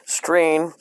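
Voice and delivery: ordinary delivery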